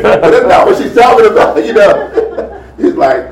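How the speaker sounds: normal talking voice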